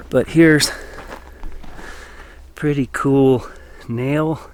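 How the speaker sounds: winded from hiking; breathless after a hike; out of breath. Real, not acted. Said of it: "Sorry I'm out of breath".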